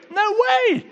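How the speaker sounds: very high-pitched outburst; high, drawn-out exclaimed protest; emphatic exclamation. Real, not acted. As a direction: cry out in indignant rejection. Preacher rejects the idea emphatically.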